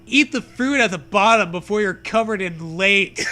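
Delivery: normal talking voice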